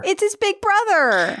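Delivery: high, gleeful exclamation; excited kid. Acted. Kid spotting older brother arriving, high excited cry. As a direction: burst out with little-brother excitement.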